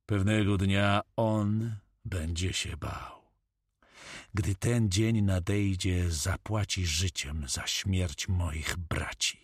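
slow, grave avenger; slow, solemn vow of vengeance; brooding corsair captain. Acted. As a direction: speak deliberately, with contained menace.

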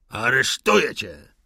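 loud furious arrest; officer shouting in rage; yelled. Acted. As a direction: roar the arrest at him. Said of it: "wrzasnął Wiesnicyn".

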